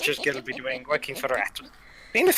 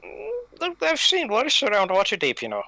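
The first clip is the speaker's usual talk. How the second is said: character voice; 8 semitones higher than usual. Acted.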